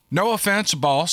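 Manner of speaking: ordinary delivery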